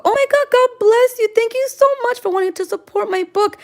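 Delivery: high-pitched squeal of thanks; gushing gratitude. Real, not acted. Streamer thanks a fan for wanting the book, gushing. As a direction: gush it out, high and thrilled.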